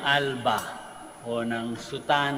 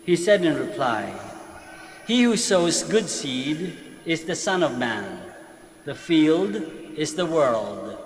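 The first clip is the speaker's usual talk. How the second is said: slow solemn proclamation; Gospel reading; 3 semitones higher than usual. Acted. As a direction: proclaim it from the pulpit.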